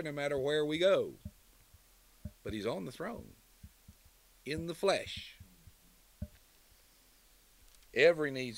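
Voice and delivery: loud, rising pulpit preaching; thundering sermon climax; full-force preaching. Acted. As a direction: proclaim it to the back pews. Preaching crescendo on the throne.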